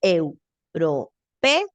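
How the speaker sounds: "Europeo" stretched very slowly, each vowel of the hiatus held apart; teacher showing the student where the hiatus is, very slowly; slow syllable demo. Shown as a demonstration. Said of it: "Ahí está el hiato".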